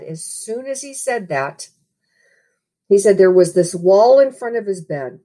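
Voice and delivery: ordinary talk, nothing marked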